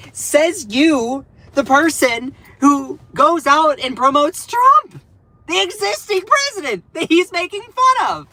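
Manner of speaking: high, loud disbelief; incredulous retort. Real, not acted. Streamer retorting to the Fox host, high and loud. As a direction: throw it back at her.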